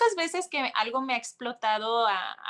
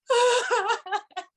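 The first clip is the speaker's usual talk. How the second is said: laughing sob; 11 semitones higher, 5 dB louder than usual. Real, not acted.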